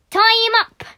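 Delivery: loud, high call; rowdy pirate order; eager shouted suggestion. Acted. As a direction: shout it out to the gang. Crew member calls out a punishment.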